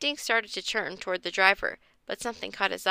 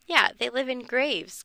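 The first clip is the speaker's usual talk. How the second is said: a bit louder, making a point; lightly raised voice; 3 semitones higher, 3 dB louder than usual. Acted. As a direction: raise the voice on the comeback. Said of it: "raising her voice".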